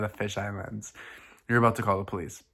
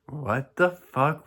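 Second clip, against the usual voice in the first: slow, raised, stretched-out disbelief; acted bewilderment; 5 semitones higher, much slower than usual. A narrator's reading. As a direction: draw out each word, mock-baffled. Reader voices bewildered partner in story.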